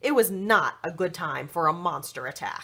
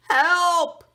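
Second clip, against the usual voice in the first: high, loud yell for help; cry for help; 10 semitones higher, 8 dB louder than usual. A narrator's reading. Goat boy cries out at the monster, loud yell. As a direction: shout it out in alarm. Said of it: "Duff said".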